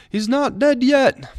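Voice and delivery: a bad British accent yelling up from below; terrible British accent. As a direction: shout it up the stairs in a hammy British accent. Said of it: "that was a terrible British, uh, accent".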